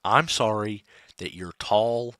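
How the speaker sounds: sarcastic apology to the cleric. Acted. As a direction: mutter the fake sorry. Grudging in-character apology.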